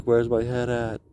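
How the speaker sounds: imitating a cave echo dying out; echo effect. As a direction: make the last word echo away. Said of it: "and it echoes".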